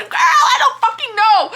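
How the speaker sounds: loud, high exasperated outburst; frustrated shout. Real, not acted. Reader venting to viewers, a frustrated shout. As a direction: throw up your hands and yell it.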